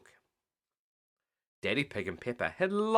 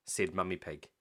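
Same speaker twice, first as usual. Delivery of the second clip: usual speaking voice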